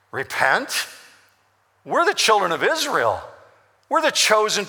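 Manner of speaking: a rising, disbelieving "Repent?"; incredulous crowd. Acted. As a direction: echo the word back in disbelief, as the crowd. Hear the crowd churning in their mind.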